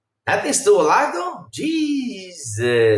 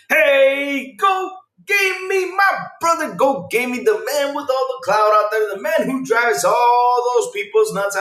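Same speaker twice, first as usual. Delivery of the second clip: announcer hype; 7 semitones higher, slower than usual. Pretend. Streamer gives a viewer a big hype intro. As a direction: hype him up like a headliner.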